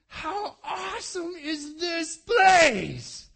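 very slow, loud, high shout of wonder; loud drawn-out shout of awe; awed shout. Real, not acted. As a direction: cry it out slowly in awe.

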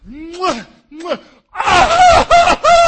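loud, high, hammed-up wailing kisses. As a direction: smack kisses then wail out. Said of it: "lifted up his voice, and wept".

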